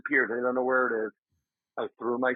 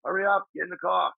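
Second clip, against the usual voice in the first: hustle him along, voice pitched up and pushing; impatient hurry-up; 6 semitones higher than usual. Retold or imitated. Buddy rushing him back to the car, mock impatient call. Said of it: "my buddy's like".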